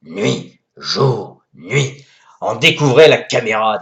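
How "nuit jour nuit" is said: low, soft, dopey repeated jour nuit; Jacouille impression. Jacouille discovering the light switch, low dopey voice. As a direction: play a dazzled medieval yokel. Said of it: "comme Jacouille la fripouille".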